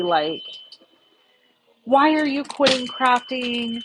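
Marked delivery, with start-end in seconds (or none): 1.8-3.8 higher, slow, accusing question; mock angry emailer. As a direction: play the irritated emailer, drawn out. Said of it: "kind of angry at me".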